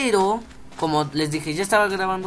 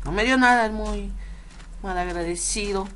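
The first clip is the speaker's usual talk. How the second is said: indignant; 4 semitones higher than usual. Real, not acted. Disappointed, no bow.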